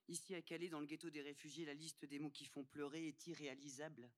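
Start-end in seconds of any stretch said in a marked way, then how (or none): none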